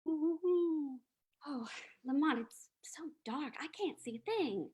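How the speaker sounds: high, hushed, fearful voice; frightened. Acted. Margot to Lamont in the dark, high frightened hush. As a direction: shiver it out, dropping toward a whisper.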